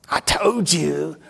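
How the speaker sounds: high, loud, drawn-out mock gloating; triumphant I-told-you-so. Fiancée calls after getting the money, gloating. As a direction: crow it in an imitated gloat, stretching it out.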